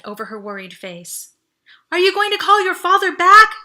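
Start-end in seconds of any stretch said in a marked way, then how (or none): 1.9-3.6 loud, high yell through a helmet. Acted. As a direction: shout over the noise. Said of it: "she yelled".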